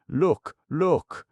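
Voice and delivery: high, loud, excited; excited command. Acted. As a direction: point and shout, thrilled. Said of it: "commanded Kaliko".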